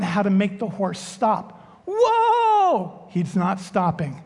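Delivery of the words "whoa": high, loud cry of alarm; panicked whoa. Retold or imitated. Pastor yells at the galloping horse, high and loud. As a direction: shout to rein in a bolting horse.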